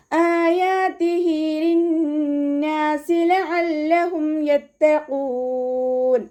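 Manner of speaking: ആയാതിഹി drawn two counts, sung slowly; tajweed recitation. Shown as a demonstration. Teacher models the verse melodically for students. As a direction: recite ലിന്നാസി with nasal ghunna, high chant. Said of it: "ഹംസയുടെ കൂടെ അലിഫ് മദ് വന്നു".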